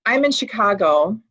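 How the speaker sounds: ordinary talk, nothing marked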